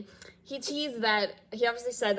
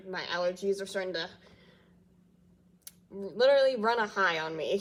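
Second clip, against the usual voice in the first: usual speaking voice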